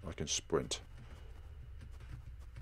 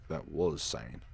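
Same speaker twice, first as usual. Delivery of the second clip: ordinary delivery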